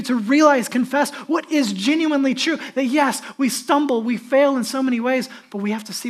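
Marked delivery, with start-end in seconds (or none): none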